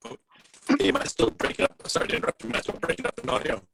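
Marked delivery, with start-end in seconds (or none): none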